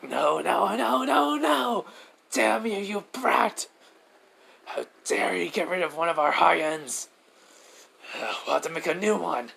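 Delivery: high-pitched villain tantrum; hammed-up high voice of frustration; Shigaraki. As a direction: whine and fume like a thwarted villain. Villain rages at losing his creature.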